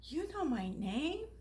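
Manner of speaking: slow, soft astonished question; hardly daring to ask; timid surprise. Acted. Punchinello asks Eli, soft and amazed.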